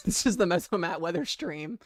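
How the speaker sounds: ordinary talk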